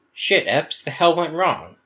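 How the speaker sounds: high-pitched alarmed demand; upset. Acted. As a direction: confront a friend in exasperation. Gamma demands an explanation after attack.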